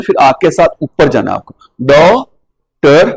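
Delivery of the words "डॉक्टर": डॉक्टर very slow, flat then rising vowel; drawn-out vowel. Shown as a demonstration. Teacher repeating डॉक्टर for class, slow and stretched. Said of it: "आ ऐसे बोलना है".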